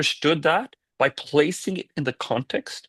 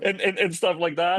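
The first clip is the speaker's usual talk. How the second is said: light laugh, higher pitch; 6 semitones higher than usual. Real, not acted. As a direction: laugh the words out. Still laughing at the stereotypes.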